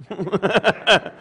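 rapid run of chuckles; real laugh. Real, not acted. Laughs at child wanting bottle.

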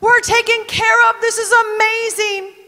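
high, joyful cry of relief; excited celebration. Acted. Ruth and Naomi celebrating the proposal, excited and high. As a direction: burst out with delight at the news. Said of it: "they're like".

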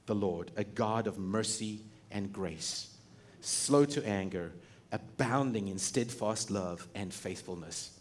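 unhurried, hushed proclamation; solemn proclamation. Retold or imitated. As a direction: proclaim it gently, savouring each word.

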